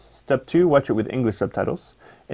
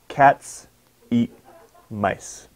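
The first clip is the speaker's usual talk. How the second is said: Cats, eat, mice each said alone with a pause; beats one by one; much slower than usual. Shown as a demonstration. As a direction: split it into single beats. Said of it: "How many stressed syllables are there?".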